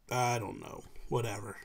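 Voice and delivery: low, soft, offhand dismissal; quiet verbal shrug; indifferent shrug. Retold or imitated. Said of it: "he don't care".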